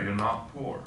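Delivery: ordinary talk, nothing marked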